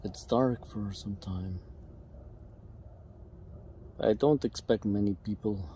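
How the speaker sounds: softly, people nearby at night; quiet voice. Real, not acted. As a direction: keep it low in the dark. Camping in dark, night.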